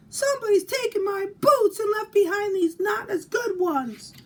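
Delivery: a cub wailing about its lost boots; wailing baby bear. Acted. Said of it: "she wailed".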